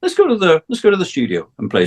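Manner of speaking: imitating [name]. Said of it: "he said".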